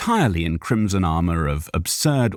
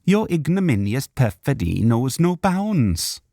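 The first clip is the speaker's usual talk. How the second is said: indignant Welsh elf; 8 semitones higher than usual. Acted. Elf accusing.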